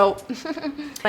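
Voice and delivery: a quick burst of real laughter; brief amused laugh; short laugh. Real, not acted. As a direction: laugh at the memory. Laughing at own story.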